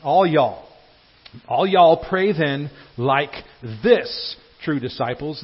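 loud, lightly Southern preacher delivery; a hint of Southern accent, projected; Southern Jesus. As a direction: stretch it out with a Southern lilt. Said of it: "this is Southern Jesus".

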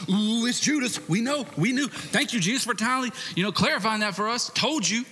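preacher imitating smug disciples; mock know-it-all apostles. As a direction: ham up the apostles gloating about Judas. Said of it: "all the apostles immediately going".

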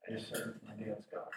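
low, very soft, halting words; subdued bad news. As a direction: break the news quietly and low. Retells news of father's death.

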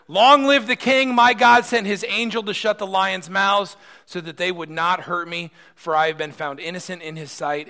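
loud, high joyful proclamation; triumphant shout. Acted. Daniel shouting to the king from the den. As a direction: call it up from the den with joy.

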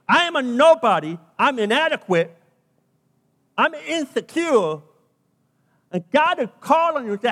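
loud, driving sermon delivery; full-force preaching. Real, not acted. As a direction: preach it hard to the congregation.

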